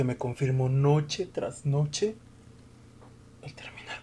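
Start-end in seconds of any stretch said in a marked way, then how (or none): 3.4-4.0 hushed whisper. Acted. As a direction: breathe the words out. Whispered suicidal confession.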